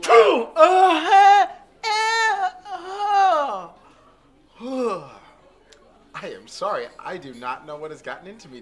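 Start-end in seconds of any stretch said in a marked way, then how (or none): 0.0-3.7 loud high sneezing fit; huge sneeze. Acted. 4.6-5.2 satisfied sigh. Acted. Relief after sneeze.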